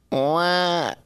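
short imitated baby wail; little baby cry. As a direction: let out a small mock baby cry. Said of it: "It made a little baby sound".

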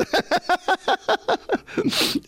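high, rapid cackle; laughing. Real, not acted. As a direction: laugh long and high at your own joke. Laughs at own joke.

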